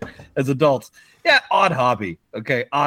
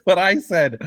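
usual speaking voice

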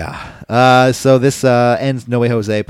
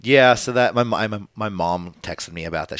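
usual speaking voice

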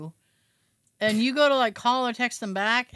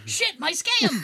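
slow, high hammed-up lament; hammy stage actor; 7 semitones higher, slower than usual. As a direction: play a foiled villain, laughing.

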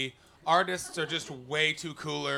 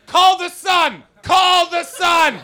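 much higher, loud headline bellow; mock shout; 13 semitones higher, 14 dB louder, much slower than usual. Tabloid crier announcing the scandal, loud and high.

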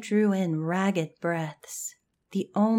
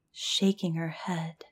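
ordinary delivery

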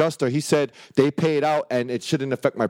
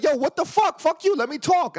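retold outburst; 14 semitones higher than usual. Man reliving cursing out a judge, pitched-up mock rage. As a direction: replay the moment you lost it, pitched up high.

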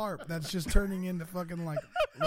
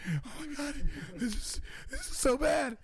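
higher, breathless panic, first line loud; panicked and out of breath; 9 semitones higher, 3 dB louder than usual. Retold or imitated. Running forties, panicking to Josh on the phone, breathless. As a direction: gasp it out, reliving the panic. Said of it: "So I'm, like, out of breath".

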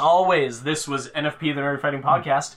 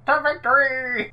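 high, drawn-out heroic cry; mock battle cry; 8 semitones higher, slower than usual. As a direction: cry it out like a charging hero. Mock battle cry with imagined sword.

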